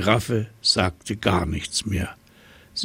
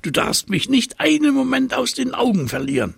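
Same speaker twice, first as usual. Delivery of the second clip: hasty monkey; 3 dB louder, faster than usual. A narrator's reading. Monkey instructs the giraffe.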